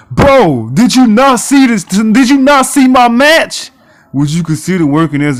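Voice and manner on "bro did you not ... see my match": loud, high indignant protest; furious disbelief, much louder; outraged. Real, not acted. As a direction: yell at the clueless GM. Outraged at GM's offer.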